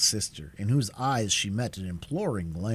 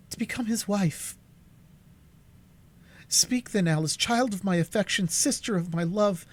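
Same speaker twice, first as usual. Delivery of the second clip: a hint of a higher, pleading elder sister; lightly voiced pleading Cora; 11 semitones higher, 3 dB louder than usual. A narrator's reading. Cora begging Alice and Duncan for counsel, lightly voiced pleading. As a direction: plead with them, raised and earnest. Cora's voice held.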